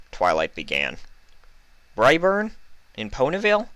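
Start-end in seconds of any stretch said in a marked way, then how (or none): 2.0-3.7 much higher, startled question; can't believe it, ask sharply; confused surprise. A narrator's reading. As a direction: Applejack surprised by the news. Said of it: "asked with a confused look".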